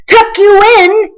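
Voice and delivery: high, loud outraged bellow; a pirate captain scoffing at tucking in. A narrator's reading. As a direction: bellow it in disbelief. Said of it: "he bellowed".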